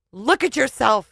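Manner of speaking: husband shouting at his wife; shouted. Acted. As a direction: push the order at her. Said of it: "he shouted".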